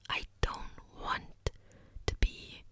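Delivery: normal talking voice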